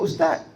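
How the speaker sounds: ustaz said as ustat, final z lost; mispronounced ustaz ending in t; sloppy ustaz. Shown as a demonstration. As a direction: end ustaz on a t instead of z. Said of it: "Ustaz tak betul".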